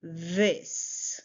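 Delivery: THIS with the opening th buzzed; pronunciation demo. Shown as a demonstration. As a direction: model THIS slowly for repeating. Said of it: "Say it with me".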